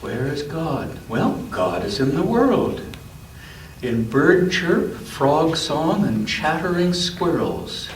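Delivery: gentle storybook read-aloud; unhurried picture-book narration; reading to a child. A narrator's reading.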